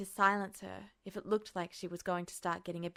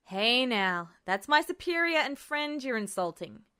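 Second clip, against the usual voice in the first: louder firm reproach; scolding; 4 semitones higher, 6 dB louder than usual. A narrator's reading. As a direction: tell her off for insulting a friend. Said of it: "he scolded her".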